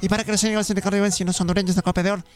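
comic rapid-fire conjuring; very fast ritual. Pretend. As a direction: race through a mock spell, pitch falling. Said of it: "un ritual muy rápido".

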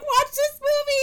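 very high, loud squeal; mock pleading. Friend urges partner to watch a sad movie, mock pleading. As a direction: squeal it as an over-the-top plea.

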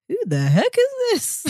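high, slow baffled question. Retold or imitated. Recalling a call saved as weirdo, high and baffled. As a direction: ask it high and puzzled, drawn out. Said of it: "And I was like".

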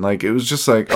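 ordinary delivery

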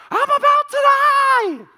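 put-on high, drawn-out child's shriek; screaming like she's dying. Dad imitating his daughter's voice as she thinks she's dying, shrill scream. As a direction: shriek it like a little girl in mortal danger. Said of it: "the sound of her voice is".

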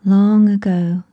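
usual speaking voice

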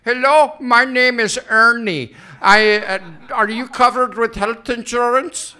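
loud put-on cold caller; telemarketer voice. Mocking telemarketer calling about insurance, loud put-on voice. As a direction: sell it like a pushy phone salesman. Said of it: "some guy who says".

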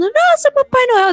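high, slow wide-eyed gasp of wonder; child's amazement. Retold or imitated. Imitating a little kid amazed at Santa, high and slow. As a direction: mimic a child awed by Santa.

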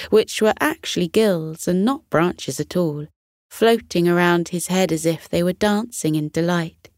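ordinary delivery

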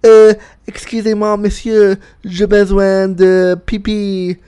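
hammed-up polite French; mock French. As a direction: play a clumsy English speaker pleading in French. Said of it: "I probably said something like".

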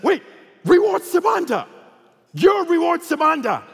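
high, loud excited call; imitated starstruck holler; loud public exclamation. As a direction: shout it so the whole plane hears. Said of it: "loud enough for the entire flight to hear".

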